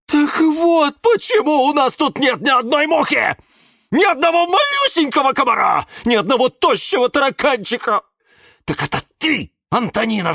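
high, loud accusing tirade; high-pitched furious interrogation; outraged shouting. A narrator's reading. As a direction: yell in indignant discovery. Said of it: "закричал Жорж".

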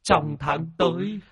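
high-pitched weepy gratitude; sniffling, tearful. Acted. Old master thanks the exorcists for saving him, sniffling. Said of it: "Ông chủ sụt sùi".

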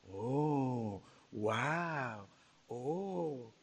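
soft low awed gasps; hushed amazement. Retold or imitated. Crowd stunned by the girl rising, hushed awe. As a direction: murmur oh wow in quiet awe. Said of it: "se quedó muy admirada".

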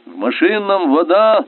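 high alarm call; shouted cry. A narrator's reading. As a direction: call out an emergency from below. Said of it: "донёсся крик Болеславского".

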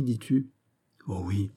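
ordinary delivery

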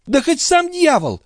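very high, impassioned outburst; high-pitched defiant cry; vehement exclamation. A narrator's reading. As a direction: exclaim it recklessly, not caring who. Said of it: "воскликнула госпожа Дюбари".

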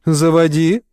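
ordinary delivery, nothing marked

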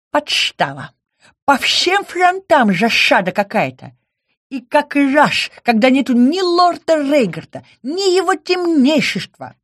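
high, loud, lisping outrage; indignant creature. Acted. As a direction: squeal it out in lisping fury. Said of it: "Возмущению кошмарня не было предела".

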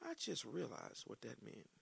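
soft, slow realization to himself; quiet aside. Real, not acted. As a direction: murmur it as it dawns on you. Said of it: "in my dream yesterday".

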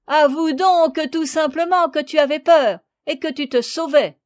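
high, loud teasing voice; laughing. A narrator's reading. Marguerite making Léon admit he ran away, laughing. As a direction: tease with laughter in the voice.

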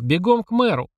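yelling. A narrator's reading. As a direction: yell the order down the line. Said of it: "заорал Святослав".

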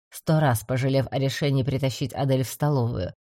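ordinary delivery

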